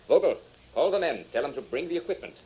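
calling an order. Acted. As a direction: bark the order to his man. Villain orders henchman across room.

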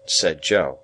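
ordinary delivery

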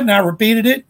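ordinary talk, nothing marked

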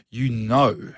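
KNOW leaned on and drawn out; stress on know. Shown as a demonstration. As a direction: say "know" as if in italics. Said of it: "italize, you know, put it in italics".

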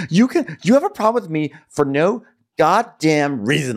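high-pitched argumentative rant; hammed-up quarrel voice; acted indignation. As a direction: argue back in mounting mock outrage.

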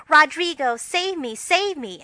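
high, loud melodramatic plea; hammed-up frantic cry for rescue. Shown as a demonstration. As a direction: wail it like a stage heroine in peril. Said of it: "crying frantically".

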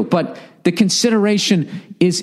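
ordinary delivery

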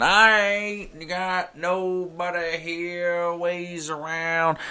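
high, very slow, put-on Southern accent; fake Southern accent. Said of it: "trying to have a fake Southern accent".

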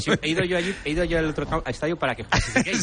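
laughing while talking. Real, not acted. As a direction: laugh through the words. Joking banter, laughs heard.

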